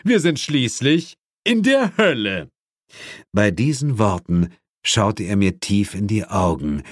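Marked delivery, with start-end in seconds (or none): 0.0-2.5 a touch of stern demon; firm, higher demon verdict. A narrator's reading. Asmodis tells his daughter sternly, a touch stern. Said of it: "sagte Asmodis streng".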